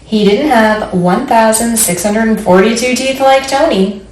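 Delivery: plain reading voice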